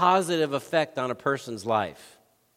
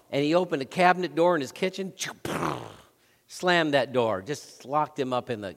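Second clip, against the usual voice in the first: normal talking voice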